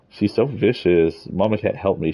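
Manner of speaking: amused complaint. Real, not acted. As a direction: complain while laughing. Complaining about biting cat.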